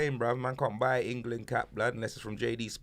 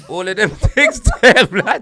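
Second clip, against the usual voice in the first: laughing; 8 semitones higher, 15 dB louder than usual. Real, not acted. Laughing at rapper's song titles.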